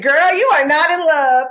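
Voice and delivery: mock-stern big-sister warning; sassy retort. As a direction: talk sense into your younger self. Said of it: "I said".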